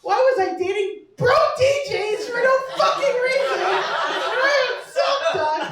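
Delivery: high, loud, laughing disbelief; shocked disbelief. Retold or imitated. Realizing the doctor site isn't for dating, shocked laughing disbelief. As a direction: squeal it out in stunned outrage. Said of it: "I was like".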